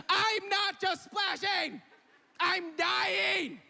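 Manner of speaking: high, slow, laughing shout; half-laughing cry of panic; desperate yell. Retold or imitated. Drowning, friends think he jokes.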